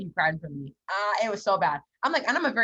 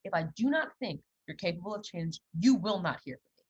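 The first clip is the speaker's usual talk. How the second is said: ordinary delivery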